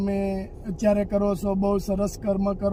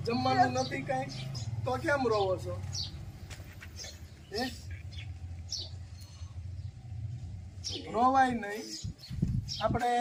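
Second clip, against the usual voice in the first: gentle consoling; 9 dB quieter, faster than usual. Real, not acted. Visitor comforts a crying elderly woman, softly coaxing. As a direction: soothe her like calming a grandmother.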